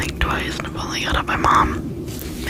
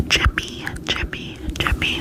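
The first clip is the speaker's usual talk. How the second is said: tingly asmr mouth sounds; 3 dB quieter than usual. Acted. Trigger word repetition.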